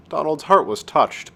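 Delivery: ordinary delivery, nothing marked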